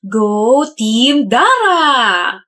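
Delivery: long drawn-out cheer; chanted send-off with drawn vowels; team cheer. Acted. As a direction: stretch the team name out slowly. Sends someone off with team cheer.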